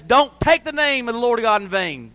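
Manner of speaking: loud, forceful decree; stern commandment. Retold or imitated. The law forbidding misuse of God's name, stern commandment. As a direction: proclaim it as the law. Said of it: "The law says".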